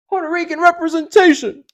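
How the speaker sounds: high, loud hype shout; celebratory cheer. Pretend. Host hyping [name]'s new album, cheer.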